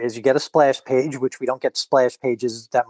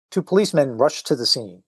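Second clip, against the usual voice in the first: ordinary delivery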